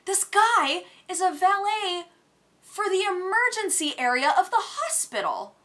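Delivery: high, indignant venting; livid rant. Retold or imitated. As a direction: rant in outraged disbelief. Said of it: "I was so livid with a person".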